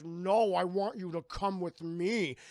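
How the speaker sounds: hammed-up stubborn order; mock demanding. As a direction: insist heavily, as a pushy character. Fred demands, acted.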